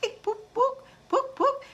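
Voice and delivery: high squeaky poke sounds; mock poking noises. Imitating people poking at you, high squeaky sounds. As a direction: squeak little pokes.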